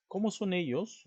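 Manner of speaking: CÓMO asked high and loud; pregunta directa. Shown as a demonstration. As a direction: raise pitch and volume to ask "Cómo". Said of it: "estás haciendo una pregunta directa". (pregunta aguda y fuerte con énfasis en "Cómo")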